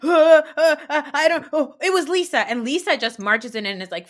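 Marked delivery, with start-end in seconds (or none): 0.0-2.4 high, louder stammering panic; flustered scrambling. Retold or imitated. Mocking the women blaming Lisa, high and flustered.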